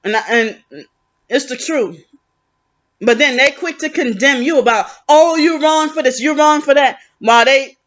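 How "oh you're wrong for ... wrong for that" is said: mocking relatives.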